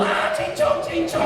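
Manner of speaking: very high mock-girlish squeal; little girl fleeing. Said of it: "run away like a little girl".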